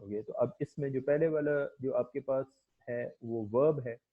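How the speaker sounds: ordinary talk, nothing marked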